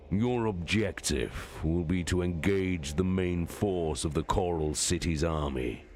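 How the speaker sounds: lightly voiced warlord, steady and measured; a hint of a commanding general; Horus giving orders. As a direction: issue the battle plan with calm authority.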